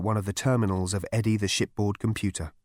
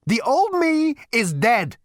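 wild unhinged ranting; 13 semitones higher, 5 dB louder, slower than usual. A narrator's reading. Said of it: "he raved".

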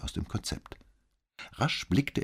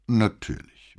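echoing repeat; 4 semitones higher, 5 dB louder, slower than usual. A narrator's reading. Commissario repeating the cadet's word, measured echo. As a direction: hand the boy's word back, falling at the end. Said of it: "wiederholte Brunetti".

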